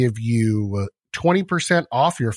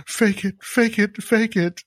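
higher, chanted mock pep talk to himself; self pep talk; 8 semitones higher, slower than usual. Host forcing a smile in the mirror, chanting a higher mock pep talk. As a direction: pump yourself up, half-mocking.

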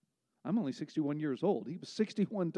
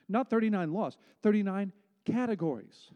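"laws" vs "categories", higher and louder; contrastive stress; 5 semitones higher, 4 dB louder than usual. Real, not acted. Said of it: "contrast of laws and categories".

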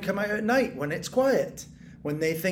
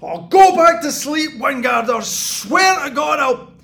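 angry shout; 7 semitones higher, 11 dB louder than usual. Acted. Neighbour shouting through wall.

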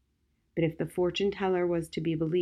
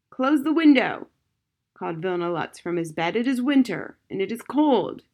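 loud demand; 3 semitones higher, 5 dB louder than usual. Acted. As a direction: bark it from the sickbed. Old soldier demanding from bed.

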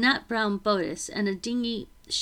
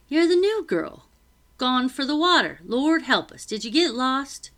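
high, loud, flustered servant woman; a busy cook, startled and fussing; flustered housekeeper; 4 semitones higher, 6 dB louder than usual. Acted. Becky flustered at the new girl.

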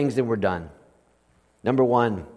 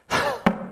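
soft breathy sigh; heavy sigh; 6 dB quieter than usual. Acted. Preacher thinking of the horrible act, heavy sigh. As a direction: let out a pained sigh.

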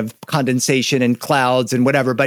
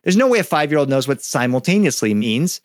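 ordinary delivery

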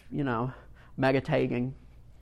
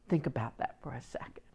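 breathy hushed aside to the congregation; 3 semitones lower, 10 dB quieter, faster than usual. Real, not acted. Preacher hushing the congregation to reflect, whispered. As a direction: lean in and hush it, let it sink in.

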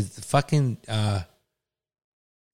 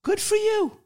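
dripping with condescension, pitched way up; condescending; slower than usual. Shown as a demonstration. Testing phrases, a condescending good for you. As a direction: talk down to them with a high sweet lilt. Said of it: "that's condescending".

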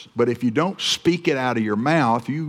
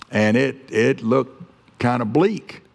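usual speaking voice

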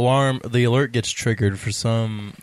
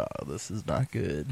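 quiet; 6 semitones lower, 12 dB quieter than usual. Retold or imitated. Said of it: "I was like".

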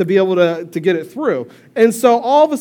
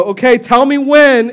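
slow, louder mock therapist prompt; drawn-out clinical instruction; imitating the therapist; 5 semitones higher, 6 dB louder, slower than usual. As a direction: therapist cranking the machine, slow and loud. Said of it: "then they go".

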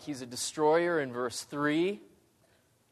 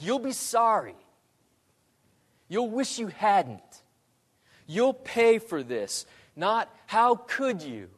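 mock threats; 6 semitones higher than usual. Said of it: "not yelling curses or threats".

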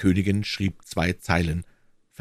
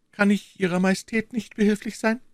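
high, gentle woman's voice; gentle, sympathetic. A narrator's reading. Young woman offers the weeping queen help, gentle and sympathetic. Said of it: "eine Stimme voll Sanftmut und Teilnahme".

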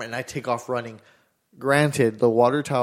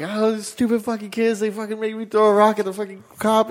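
put-on high-pitched, worked-up kid blaming others; retold frantic excuse; 9 semitones higher, 3 dB louder than usual. Kid explaining the thrown rock to mom, retold frantic excuse. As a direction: protest in a high, mock-frantic voice.

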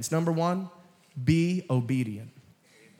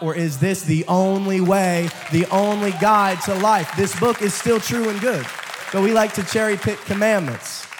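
emphatic preaching; 3 semitones higher, 5 dB louder than usual. Acted. Preaching at full force.

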